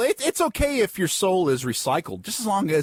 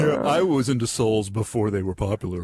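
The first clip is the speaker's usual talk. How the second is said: a hint of smug hipster, lower; lightly voiced hipster; 6 semitones lower than usual. Hipster boasting about souls to podcast friends, lightly mock hipster. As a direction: play the hipster lightly, pitched down. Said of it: "then some hipster soul gets it".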